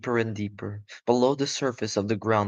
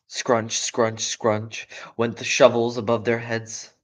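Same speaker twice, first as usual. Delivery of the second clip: usual speaking voice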